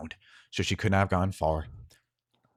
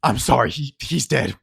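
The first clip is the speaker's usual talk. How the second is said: a guard breaking bad news, raised; lightly voiced guard, urgent and sorry; grim guard; 5 semitones higher, 7 dB louder than usual. Guard telling Harlan his son is dead. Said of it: "Another guard goes".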